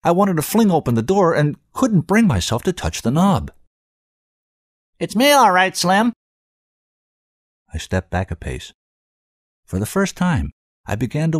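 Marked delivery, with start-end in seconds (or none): 5.0-6.1 high cartoon voice, loud; comedy voice. A narrator's reading. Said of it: "A comedy voice".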